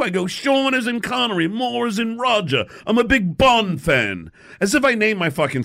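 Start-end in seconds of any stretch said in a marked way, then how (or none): 0.3-4.3 drawn-out fake English accent; bad English accent. Prank caller explains his fake name, bad English accent. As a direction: spell out names in a hammed-up English accent. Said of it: "with his bad English accent".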